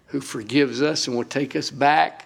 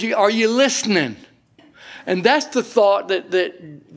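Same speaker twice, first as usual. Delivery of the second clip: usual speaking voice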